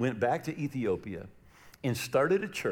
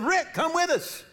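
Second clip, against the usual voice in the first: urging; 4 dB louder, slower than usual. Retold or imitated. Retold urging Rick.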